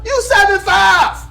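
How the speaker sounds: loud emphatic shout. Real, not acted. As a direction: hammer the point home loudly. Repeating point, shouting.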